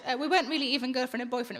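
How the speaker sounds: ordinary delivery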